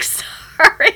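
high, loud, drawn-out sorry; sheepish mock apology. Wife owning up to her scared husband, mock apology. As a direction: sing out an apology on a laugh.

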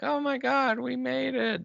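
hammed-up joy at surviving; relieved excitement. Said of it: "and they're like".

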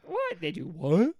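high-pitched incredulous squeak; hammed-up shocked questions, voice shooting up; horrified disbelief. Dad imitating appalled kids hearing of circumcision, incredulous. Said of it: "they're like".

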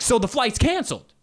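high-pitched indignant passenger impression; hammed-up outrage, pitched well up; mock outraged crowd. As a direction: exclaim it like passengers up in arms. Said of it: "People are up in arms".

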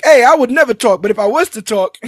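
hammed-up snitch, close to laughing; mock goon informant. Goon about to spill the beans, mock informant voice.

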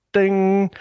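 high sung ding; imitated chime. Mimicking the film's opening crawl, high chime.

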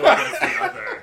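laughing. Real, not acted. Laughing through punchline.

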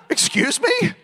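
high, squeaky mock disbelief; incredulous. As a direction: act like you can't believe your ears. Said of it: "I'm like".

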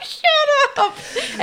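laughing shut up. Retold or imitated. Own reply, laughing.

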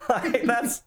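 laughing high in disbelief. Real, not acted. Reacting to Ray burying a man alive, laughing. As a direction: laugh through the words, pitch jumping up.

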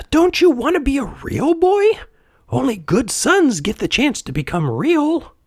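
very high, tiny coaxing voice; squeaky insect moralising; cricket. A narrator's reading. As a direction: coax like a tiny wise cricket. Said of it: "The cricket gave a sigh".

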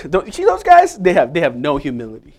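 mock the smug believer pointing at others. Mocking the self-righteous.

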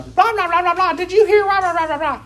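very high squeaky gossip chatter. Retold or imitated. Sister Martha gossiping to Sister Clara, squeaky high chatter. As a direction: squeak it like a busybody at the workshop. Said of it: "and going".

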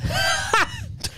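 very high, shrieked laugh; imitated burst of laughter. Imitating the German teacher reading the note, high burst of laughter. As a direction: mimic someone bursting out laughing. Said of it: "he just went".

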